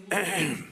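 short imitated throat clear. Preacher imitates man in next stall, throat clearing. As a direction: clear your throat like a stranger nearby. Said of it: "hear someone clear their throat".

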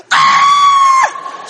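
very high, loud mock shriek; piercing put-on scream; imitated holler. Preacher imitates wife hollering in church, shrieked "Ah!". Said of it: "She hollered again".

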